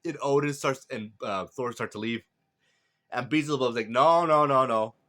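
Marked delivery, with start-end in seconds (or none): 3.9-4.9 rapid-fire protest, raised and loud; loud objection. A narrator's reading. Beelzebub stops Odin leaving, loud protest. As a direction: burst in to stop him, loudly.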